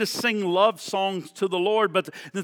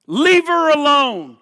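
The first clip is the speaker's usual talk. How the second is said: commanding rebuke; 6 semitones higher, 7 dB louder than usual. Acted. Repeats Jesus' rebuke.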